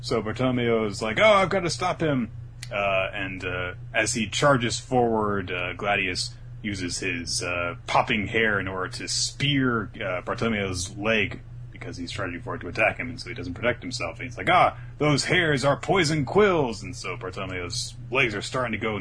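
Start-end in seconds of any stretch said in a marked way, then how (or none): 1.2-2.3 high, loud mock alarm; alarmed resolve. Acting Bartolomeo in alarm. 14.5-16.8 high, loud, drawn-out mock alarm; alarmed realisation. Acting Bartolomeo realising danger.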